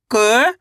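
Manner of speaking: "क" alone, held and rising; letter by letter. Shown as a demonstration. Teacher shows the method, isolated rising "क". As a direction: give "क" as a separate long sound. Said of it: "किस तरीके से एक अक्षर का उच्चारण".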